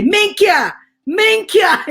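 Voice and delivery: high, loud mock Italian curse; imitated Italian swearing. Retelling what sounded like swearing in Italian, high mock cursing. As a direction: exclaim it like an Italian curse, laughter creeping in. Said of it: "she was swearing to me in Italian".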